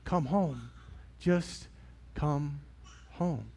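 very soft, word by word; slow it down, each word separate, almost whispered; gentle fading invitation; hushed tender plea. Pretend. Father's answer to daughters.

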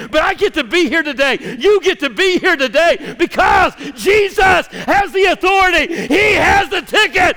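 high, loud shouted preaching, key words stretched out; full-force preaching. Real, not acted. Preacher telling the church Jesus called us, shouted exultantly.

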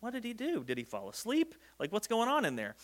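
soft, puzzled crowd voice; hushed imitation of people speculating; murmured wondering. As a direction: wonder aloud under your breath.

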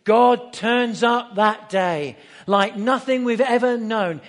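loud, slow, drawn-out proclamation; preacher's booming declaration; emphatic proclamation. Acted. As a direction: proclaim it slowly at full volume. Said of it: "what he could say is this".

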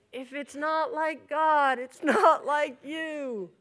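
slow, drawn-out sing-song; imitating a voice from the back of the mind; inner voice. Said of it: "I hear my own voice saying".

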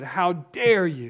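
slow indignant retold rebuke; retold angry outburst. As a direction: spit each word out slowly, offended. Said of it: "She was full of anger".

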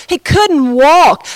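ordinary talk, nothing marked

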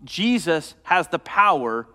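preaching. Acted. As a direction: drive it home to the congregation. Preaching builds to full force.

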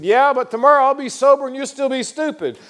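high, loud retort; heckler's comeback. Retold or imitated. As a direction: top the other man's jibe. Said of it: "the man came back. He said".

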